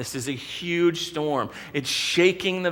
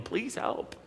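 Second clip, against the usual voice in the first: whispered plea; 7 semitones higher, 8 dB quieter than usual. Retold or imitated. Disciples pleading in storm.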